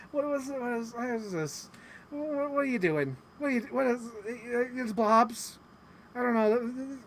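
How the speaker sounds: high, stammering mock confusion; agitated. Imitating an actress shown a pixelated image, agitated. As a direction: imitate someone flustered, restarting every question. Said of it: "just seemed so agitated".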